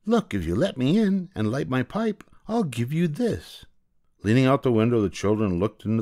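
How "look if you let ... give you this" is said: higher tempting witch voice. Acted. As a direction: dangle the bribe like a hag. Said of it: "she said".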